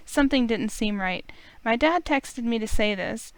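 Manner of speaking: ordinary talk, nothing marked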